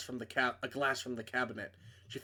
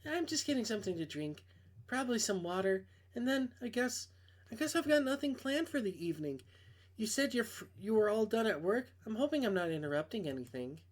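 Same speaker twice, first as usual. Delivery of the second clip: Maeve, lightly voiced, a touch higher; a hint of a nervous young woman; girl voice; 7 semitones higher than usual. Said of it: "I can't do girl voices".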